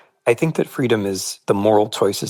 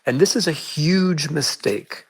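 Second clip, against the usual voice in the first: shaky, unsteady voice, higher and slow; wavering voice; 7 semitones higher, slower than usual. Real, not acted. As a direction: let the voice waver and wobble. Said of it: "sorry if my voice is wavering a bit".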